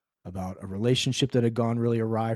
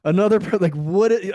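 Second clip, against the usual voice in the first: louder, higher fed-up groan; exasperated groan; 6 semitones higher, 6 dB louder than usual. Retold or imitated. Recalling the McDonald's client, loud exasperated groan. Frustration at another weight-loss client.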